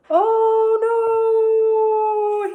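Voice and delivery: high, loud sung wail; cried. A narrator's reading. Anthony flying into the air, high sung wail. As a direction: wail it as he flies through the air. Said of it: "he cried".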